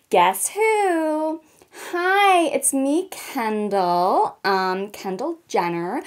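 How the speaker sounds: mock American accent as Kendall Jenner, valley-girl vibe; terrible American accent. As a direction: do a hammed-up American model voice. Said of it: "my terrible American accent".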